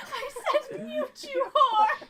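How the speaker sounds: retold laughing yell. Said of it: "and I was like".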